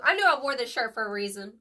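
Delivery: said through a laugh; tickled by the coincidence; chuckling while speaking. Real, not acted. Laughing at her shirt coincidence.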